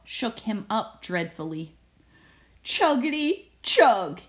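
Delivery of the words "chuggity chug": slow, louder chugging moan; a tired bus engine groaning. Acted. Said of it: "he groaned".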